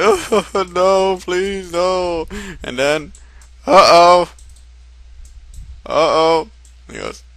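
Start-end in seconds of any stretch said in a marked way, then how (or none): 0.0-2.3 high, loud, whimpering plea; mock pleading. Character pleads in acted scene. 3.7-6.5 loud, high "uh-oh"; mock alarm. Character alarmed in acted scene.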